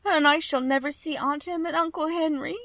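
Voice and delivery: put-on very high, tearful girl's wail; beginning to cry. A narrator's reading. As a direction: start to cry as a girl, pitch held high. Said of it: "beginning to cry".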